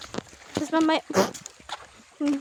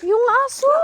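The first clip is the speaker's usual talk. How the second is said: shouting; 8 semitones higher, 8 dB louder than usual. Real, not acted. Warning shout about the dog.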